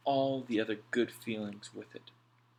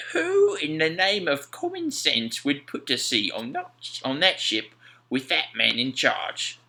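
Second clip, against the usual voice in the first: louder, slow scornful sailor voice; Mac, scoffing sailor; 6 semitones higher, 6 dB louder, slower than usual. A narrator's reading. Mac to Alf about the Neverland, slow scornful voice. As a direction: scoff at anyone sailing on her.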